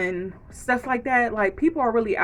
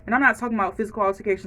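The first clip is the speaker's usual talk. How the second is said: normal talking voice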